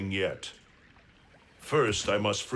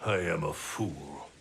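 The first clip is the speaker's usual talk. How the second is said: lower, softer self-reproach; remorseful; 8 semitones lower, 5 dB quieter than usual. Acted. Raiden admitting his mistake to his brother, quiet remorse.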